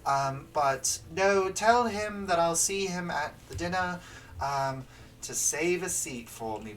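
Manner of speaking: high, loud princess voice; cartoon princess. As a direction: play the prim storybook princess. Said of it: "She's like".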